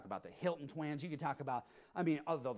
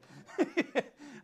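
a quick amused laugh; short laugh; 7 semitones higher than usual. Real, not acted. Preacher laughing at his own anecdote, brief chuckle. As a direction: laugh at your own story.

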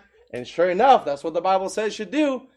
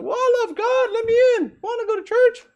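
mocking, much higher put-on voice, loud; sneering imitation of a pleading churchgoer, pitched way up; 4 dB louder than usual. Preacher mocks a would-be churchgoer in a high sneering voice. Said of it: "It's like".

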